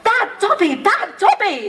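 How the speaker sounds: high mock shout at himself. A narrator's reading. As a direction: punish himself with high cries.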